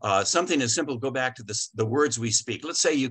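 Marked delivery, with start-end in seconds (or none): none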